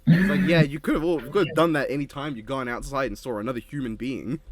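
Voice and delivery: a playful exasperated telling-off; exasperated loud rant. Real, not acted. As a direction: call out the excuse in mock outrage. Exasperated ribbing of co-host.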